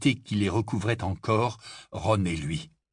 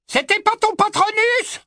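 very high, strained shout; shouted retort; 6 dB louder than usual. A narrator's reading. Death Eater disputing the barman's patronus, shouting back.

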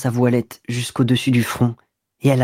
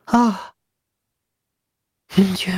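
dazed murmur; 7 semitones higher, slower than usual. Acted. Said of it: "Puis elle murmura, comme tombée dans une sorte d'hébétude".

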